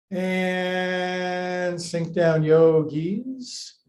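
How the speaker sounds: very slow, stretched-out words; drawn-out slow cue. Acted. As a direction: let the words sink slowly down. Teacher cues slow sinking.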